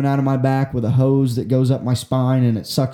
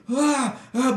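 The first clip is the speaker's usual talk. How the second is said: stifled scream; 11 semitones higher, 3 dB quieter than usual. Retold or imitated. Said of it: "I'm, like, screaming".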